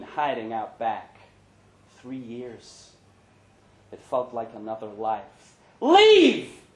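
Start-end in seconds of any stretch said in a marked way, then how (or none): 5.8-6.6 very high, loud shout; angry order. Retold or imitated. Brenda throwing Larry out of the bar, loud shout. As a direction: throw him out. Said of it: "she said".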